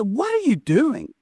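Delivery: high, slow, stunned "what are you doing"; incredulous question. Retold or imitated. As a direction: ask it in disbelief, drawn out and high. Shocked question to brother.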